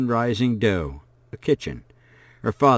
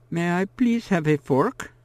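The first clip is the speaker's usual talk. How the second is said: put-on higher, small child's request; polite little girl; 8 semitones higher than usual. A narrator's reading. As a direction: ask sweetly like a shy girl. Blind girl asking politely.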